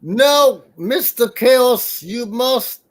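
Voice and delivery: high, drawn-out wrestler impression; Iron Sheik. Wrestler scolding his target, hammed-up Iron Sheik voice. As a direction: scold in broken-English wrestler voice. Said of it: "I am Iron Sheik".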